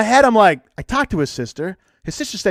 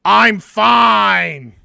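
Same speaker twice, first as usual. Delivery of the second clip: imitated curt reply, higher and louder; mock an annoyed, sarcastic "I'm fine"; sarcastic, pissed off; 4 semitones higher, 6 dB louder than usual. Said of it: "in the most sarcastic, pissed off voice".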